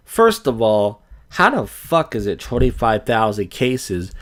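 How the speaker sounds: ordinary talk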